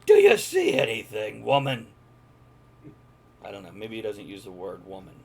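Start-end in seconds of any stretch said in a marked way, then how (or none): 0.1-1.9 loud angry shout from afar; yelling. Acted. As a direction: bellow it across the cliff. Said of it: "Till Jaith yells".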